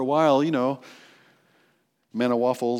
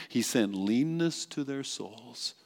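solemn reading; 4 semitones lower, 8 dB quieter, slower than usual. Shown as a demonstration. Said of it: "I love the King James Version".

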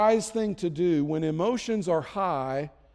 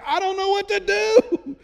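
much higher, louder helpless wail; panicked parent; 6 dB louder than usual. Retold or imitated. New parent lost with the kids, mock panicked wail. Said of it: "and it's like".

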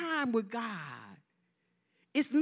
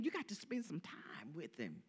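much lower and softer, almost to herself; dropped low and soft; 8 semitones lower, 14 dB quieter than usual. Real, not acted. Preacher urges the church, dropping to a hush. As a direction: let the line sink to a hush.

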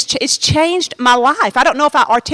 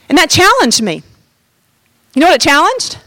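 normal talking voice

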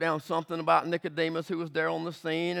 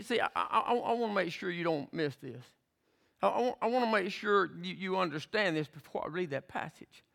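ordinary talk

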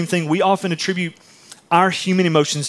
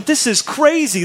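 amazed; 9 semitones higher, slower than usual. Retold or imitated. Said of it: "you're just going".

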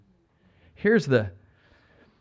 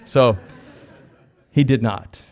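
ordinary delivery

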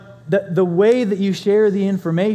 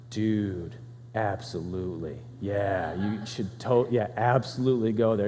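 low and soft, quietly impressed; hushed, lowered tone; 7 semitones lower, 8 dB quieter than usual. Retold or imitated. Friend quietly recommending a restaurant, low hushed voice. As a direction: drop the voice, quietly sold on it. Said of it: "it went from".

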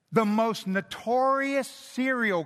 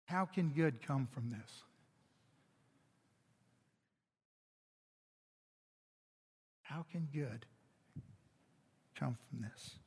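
much softer, lower self-doubting murmur; despairing murmur; 6 semitones lower, 12 dB quieter than usual. Retold or imitated. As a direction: say it as a defeated thought to yourself. Voicing a despairing inner thought.

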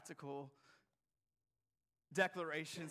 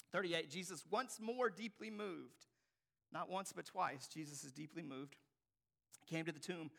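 ordinary talk, nothing marked

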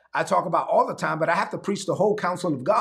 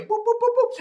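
high boops. Acted. Imitating rising up high.

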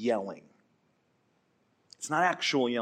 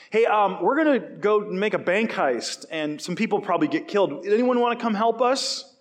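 louder, higher, cheerfully brazen recruiting call rising at the end; mock robber announcement; 6 semitones higher, 4 dB louder than usual. Robber inviting coffee shop patrons to a heist, hammed-up call.

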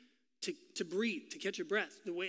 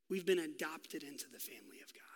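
soft, lower whisper; preacher whispering adoption, softer and lower; 4 semitones lower, 8 dB quieter than usual. Real, not acted. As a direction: say it like a secret gift. Preacher hushed emphasis.

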